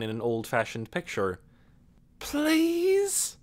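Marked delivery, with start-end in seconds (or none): 2.2-3.4 high pleading please; begging please. Acted. Amanda begging Nikki's forgiveness, high plea. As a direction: beg with clasped hands.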